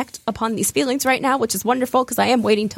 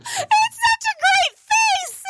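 laughing squeal; much slower than usual. Real, not acted. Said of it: "laughing at her face".

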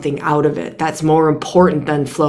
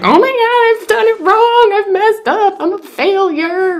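very high, breathless mock panic, slowing at the end; hammed-up high-pitched despair; mock panicked self-critic; 3 dB louder than usual. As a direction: squeal it like a frantic perfectionist. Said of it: "Just like".

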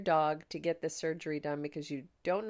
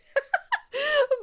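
sudden high bright laugh at herself; burst of laughter; 10 dB louder than usual. Real, not acted. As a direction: laugh out loud at your own drama. Laughing at own exaggeration.

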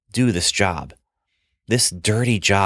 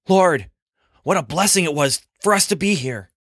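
excited awe; 7 semitones higher than usual. Acted. Peter excited on the mountain.

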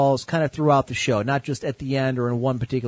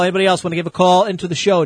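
ordinary delivery

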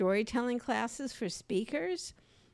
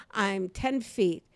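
normal talking voice

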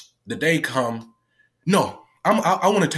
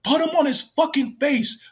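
imitating yelling. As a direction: retell her yelled order in a much higher voice. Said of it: "she was yelling at me".

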